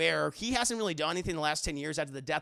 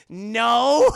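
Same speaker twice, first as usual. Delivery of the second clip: loud, higher, drawn-out no; loud refusal; 4 semitones higher, 13 dB louder than usual. Acted. Bruce turning down the stuffed bear, loud refusal. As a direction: blurt it out loudly, exaggerated. Bruce's acted reply to meeting Wooby.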